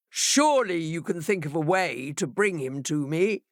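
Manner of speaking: lightly voiced commanding sea monster; a hint of a lordly demand; Leviathan demanding. A narrator's reading. Leviathan ordering the fishes, mildly commanding. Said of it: "demanded Leviathan".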